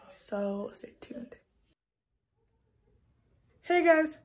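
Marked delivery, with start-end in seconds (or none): 0.1-1.4 whispered stay tuned; whispering. Real, not acted. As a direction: breathe it out, no voice. Whispered sign-off.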